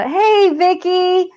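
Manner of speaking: high, slow drawn-out hello to a friend; greeting a Navy roommate who just showed up, high and slow; excited greeting. Real, not acted. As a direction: call out happily to a friend. Greeting friend watching live.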